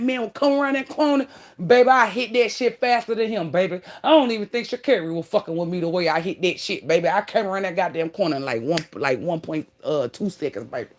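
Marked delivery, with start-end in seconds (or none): none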